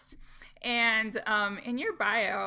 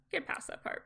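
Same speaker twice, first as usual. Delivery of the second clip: whispered aside, softer; 4 semitones higher, 8 dB quieter than usual. Shown as a demonstration. Podcaster whispering advice to squeamish listeners. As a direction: drop to a whisper. Repeats the phrase, then whispers it.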